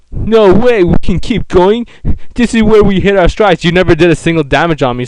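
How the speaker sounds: high, loud mock defiance; indignant, high-pitched trash talk; cocky battle taunt.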